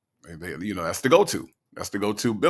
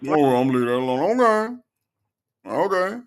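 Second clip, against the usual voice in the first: backing down with a higher "okay"; mock cowering Dre; 5 semitones higher, 4 dB louder than usual. Host mocking Dre backing off the phone argument, cowering. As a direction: mimic him meekly dropping it. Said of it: "the way Dre cowers down".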